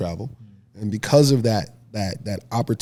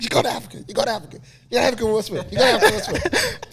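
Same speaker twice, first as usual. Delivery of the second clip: imitating mom's excited scream; 13 semitones higher, much faster than usual. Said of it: "She's screaming".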